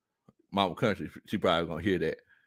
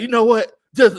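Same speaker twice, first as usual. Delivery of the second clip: mock wife; 11 semitones higher, 11 dB louder than usual. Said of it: "this is how she talks to me".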